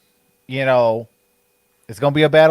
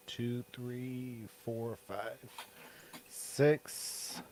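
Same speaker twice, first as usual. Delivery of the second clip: quiet muttered counting; counting under breath; 3 semitones lower, 23 dB quieter, much slower than usual. Real, not acted. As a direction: count softly to yourself. Counting games quietly to himself.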